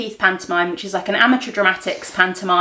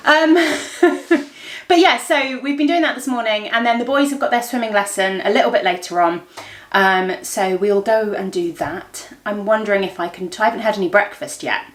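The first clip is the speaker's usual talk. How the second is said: ordinary talk, nothing marked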